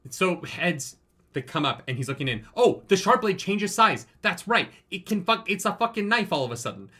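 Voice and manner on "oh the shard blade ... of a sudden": excited recall. Real, not acted. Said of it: "That was crazy".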